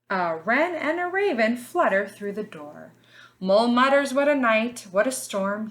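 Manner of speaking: put-on lively storybook read for little kids; reading to children. A narrator's reading. Teacher reading a picture book to her class, lively storybook voice.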